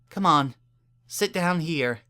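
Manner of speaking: a caring mare coaxing a stranger; kind Earth Pony mayor. A narrator's reading.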